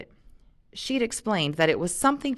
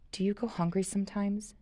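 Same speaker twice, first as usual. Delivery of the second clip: softer, quiet concern; low voice; 4 semitones higher, 6 dB quieter than usual. A narrator's reading. Jen quietly asking Luke if he goes hungry, low voice. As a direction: ask it low and gently. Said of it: "she asked in a low voice".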